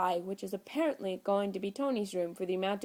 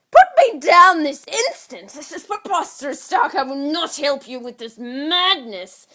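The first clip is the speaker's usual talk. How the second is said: indignant protest; 8 semitones higher, 14 dB louder than usual. Acted. Loki over Tony's shoulder, loud high outraged yell. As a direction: demand it like a furious small prince.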